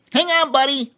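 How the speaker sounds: high bright chimp call; chiming chimp. A narrator's reading. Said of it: "Cheemy chimed".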